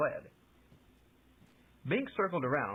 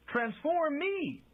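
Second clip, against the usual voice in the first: high, slow, emphatic call; inspired cry; 3 dB louder, much slower than usual. A narrator's reading. Bink struck by an idea, crying out high and slow. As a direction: burst out with the idea. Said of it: "Bink cried with sudden inspiration".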